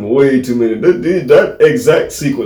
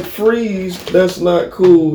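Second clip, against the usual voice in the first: high, slow mock police order; mock cop; 7 semitones higher, much slower than usual. Friend imagining police at his door, mock cop. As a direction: play a cop calmly scolding. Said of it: "Police show up, be like".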